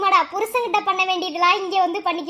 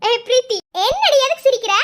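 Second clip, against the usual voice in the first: louder, higher demand; insistent call; 4 semitones higher, 5 dB louder than usual. Acted. Asking a friend why she is laughing, insistent. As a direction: call out sharply and demand an answer.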